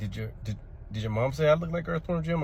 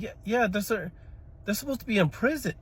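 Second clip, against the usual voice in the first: put-on higher, confused little-boy voice; puzzled kid; 10 semitones higher than usual. Confused child questioning the movie in class. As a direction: play it as a baffled child.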